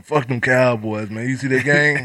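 slow, loud sports rant. Real, not acted. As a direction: shout it out slowly. Football rant about Cowboys.

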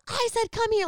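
high, loud, stern insistence; losing patience with his sister; imitating an angry brother; angry repeat. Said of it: "he's really angry at me".

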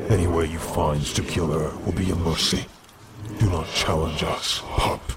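a council elder threatening a defiant pup; cold threat. Acted. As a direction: lay out the threat coldly, word by word. Council threatens to kill sister.

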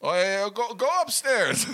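high, loud, silly put-on voice; mock maintenance guy. Guest mocking the maintenance guy's advice, high and silly. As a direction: ham up the clueless maintenance man.

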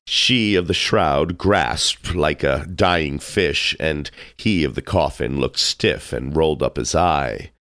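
plain reading voice

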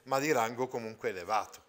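normal talking voice

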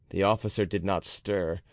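ordinary delivery

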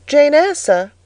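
put-on high child's voice answering up; obedient little girl. A narrator's reading. Little Jane giving her name to the stranger, high obedient child. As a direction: answer a stern elder as a small girl.

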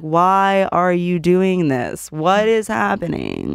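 slow, calm questions; slowed calm words. Retold or imitated. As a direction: draw the words out calmly, as if time slowed. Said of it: "Even, like, my words".

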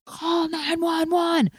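high, slow, mock urgent call; retold yell. A bystander shouting at her to call 911, mock yell. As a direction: draw it out like a stranger shouting from the street. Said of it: "a guy yelling at me".

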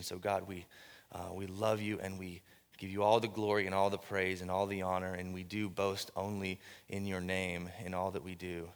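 low, soft, reverent prayer. Acted. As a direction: pray it quietly and low.

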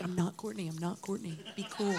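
hushed, as if not to be noticed; retold hushed plea. Singer retelling nerves about being mistaken for Courtney, hushed plea.

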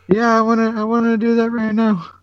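very high, squeaky giddy excitement; giddy chipmunk voice. Retold or imitated. Fan answers his hero, giddy and chipmunk-high. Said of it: "giddy and chipmunkish".

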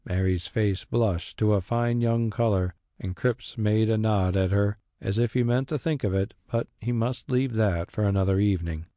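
plain reading voice

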